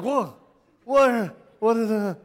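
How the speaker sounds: high, loud startled yelps. Retold or imitated. Preacher retells jumping at his friend's cry, startled high yelps. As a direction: jump out of your skin and ask. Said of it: "I was like".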